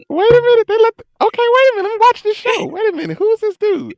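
loud, very high-pitched double take; mock astonishment. Viewer discovering the new Lupin on Netflix, loud mock astonishment. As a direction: act out a hammed-up startled reaction. Acting out his reaction to show.

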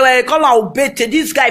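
normal talking voice

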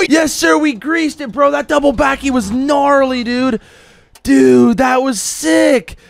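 high excited cheering; frat bro. Real, not acted. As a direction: hype it up like a bro. Cheering after landing a trick.

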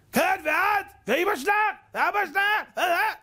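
hammed-up high squawking in short bursts; mock quarrel shouting. Foolish husband insulting his wife's parents, mock quarrel shouting. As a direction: imitate a fool hurling insults, very high-pitched. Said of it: "אבל הטמבל מה עושה?".

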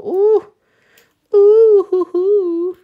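loud, high delighted hooting; excited high "ooh". Real, not acted. Admiring her big flower, high excited hoot. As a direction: whoop high over your own work.

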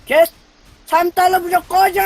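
sudden angry yell; shouting. Real, not acted. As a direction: bark it at the screen. Yelling at enemy in game.

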